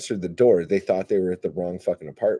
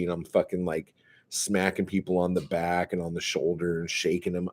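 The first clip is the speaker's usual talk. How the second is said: ordinary talk, nothing marked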